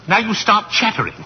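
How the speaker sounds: ordinary talk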